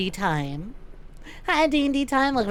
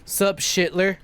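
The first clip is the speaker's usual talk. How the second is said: 1 cool Duke greeting; 5 semitones lower, slower than usual. Retold or imitated. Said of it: "Duke Nukem walks into a room and goes".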